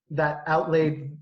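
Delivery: ordinary talk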